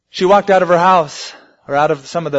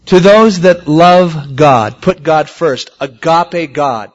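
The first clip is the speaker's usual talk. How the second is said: emphatic preaching; 8 dB louder, slower than usual. Acted.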